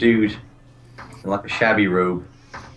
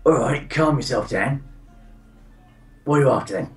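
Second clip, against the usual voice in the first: put-on Cockney accent; 4 semitones higher than usual. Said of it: "the guy looks at you and goes".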